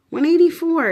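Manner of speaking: high, loud stunned repeat of a number; stunned emphasis, voice jumping up; incredulous. Real, not acted. Shocked by own blood pressure reading.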